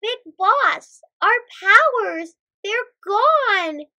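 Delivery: high, loud, dramatic distress; alarmed cry. A narrator's reading. Mikael tells Big Boss about lost powers, crying out. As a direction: cry out the bad news to the boss. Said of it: "cried Mikael".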